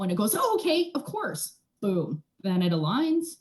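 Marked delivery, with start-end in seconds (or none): 0.4-1.6 high-pitched, obliging little voice; compliant reply. Acted. Practitioner voices the sternum obeying her, high compliant voice. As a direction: pipe up high in instant agreement, then soften.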